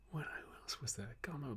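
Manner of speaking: whispered to self. Real, not acted. Muttering, trying to remember.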